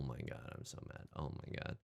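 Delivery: hushed, seething whisper; whispered frustration. Real, not acted. Losing streamer whispering to himself, frustrated whisper. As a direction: whisper the fury under breath. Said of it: "I'm so mad right now".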